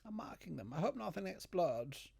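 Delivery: usual speaking voice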